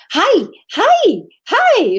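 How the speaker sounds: put-on high, loud little-kid hellos; excited child greeting. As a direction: imitate an excited small child calling out. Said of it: "She was all excited that somebody".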